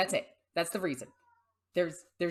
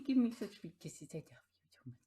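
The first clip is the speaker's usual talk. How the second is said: whisper to pet. Real, not acted. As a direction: whisper it to the cat lovingly. Whispering to her cat.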